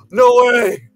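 gasp, then high, loud fake disbelief; hammed-up scandalised outburst; mock shock. Mocks public shock at the news.

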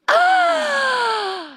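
a hammed-up shocked gasp, high and sharp; mock gasp. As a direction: gasp as if scandalized by the quote.

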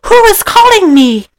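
very high put-on woman's voice; mock-feminine, sky-high questioning; movie starlet. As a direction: play a starlet surprised in her bath. Acting starlet called in her bath.

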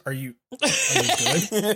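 slow, mock-caring voice through a laugh; lightly voiced smug fighter; mock concern. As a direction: drag it out like you're barely trying. Mocking Baki checking on opponent.

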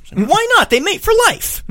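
high goofy mock whine. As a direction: squeak it like a wounded cartoon. Mock voice for Mike's reply.

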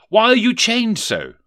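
high, loud, alarmed question; trembling question. Acted. Scrooge questions the chained ghost, high and fearful. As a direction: ask it fearfully, pitched right up. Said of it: "asked a trembling Rishi Scrooge".

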